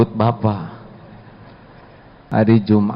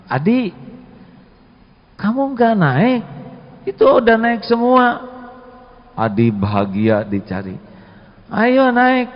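calling out; 12 semitones higher than usual. Acted. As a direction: shout for Adi to hop on. Friend calls Adi onto bus.

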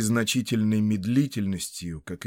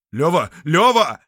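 frantic calling; 7 semitones higher, 10 dB louder than usual. Acted. Panic over the bloody hand.

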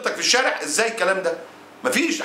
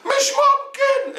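very high, drawn-out, laughing cry; mock disbelief; much slower than usual. Someone hearing that a man died, hammed-up disbelief. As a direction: ham up the shock. Acting shocked reaction to a death.

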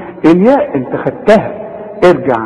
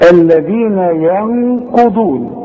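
slow Quran recitation; 3 semitones higher, much slower than usual. Shown as a demonstration. Teacher recites the verse for the student. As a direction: chant الذين ينقضون slowly with a heavy qaf. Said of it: "قلت ينقضون الذين".

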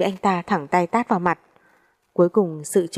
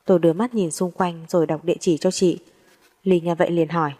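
ordinary delivery, nothing marked

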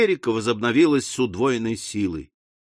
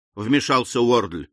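ordinary delivery, nothing marked

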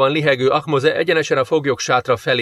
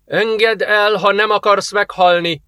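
high-pitched, forceful threat; threatening command; 8 semitones higher, slower than usual. A narrator's reading. Jenna aims her weapon at the merchant, threatening. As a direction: threaten them at gunpoint. Armed threat to release captive.

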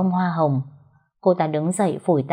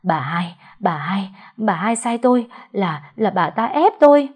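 stammering, rising into a frightened cry; teeth chattering; 3 semitones higher, 3 dB louder than usual. A narrator's reading. Shaking servant names his mistress to the ghost. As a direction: stutter louder as fear builds. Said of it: "hai hàm răng va vào nhau cầm cập".

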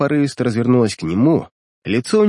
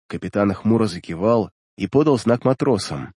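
ordinary delivery

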